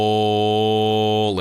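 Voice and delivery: loud, falling group chant of Skull; rowdy toast shout. Acted. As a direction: bellow the toast like a warrior. Warriors chant toast in hall.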